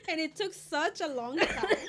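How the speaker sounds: high, loud words through laughter; laughing while talking. Real, not acted. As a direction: let laughter break through the sentence. Joking about the late death.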